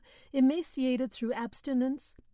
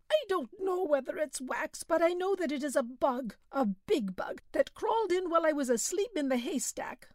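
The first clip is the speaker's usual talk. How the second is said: complaining peasant; 6 semitones higher than usual. A narrator's reading. Suffering peasant Belhomme voiced.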